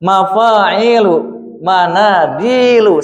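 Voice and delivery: "Mafa'ilu", "manadilu" stretched very slowly, vowel by vowel; very slow comparison. Shown as a demonstration. Teacher to confused student, comparing the vowel patterns very slowly. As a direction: read both words very slowly side by side. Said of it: "Harokatnya sama juga".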